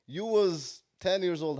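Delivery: usual speaking voice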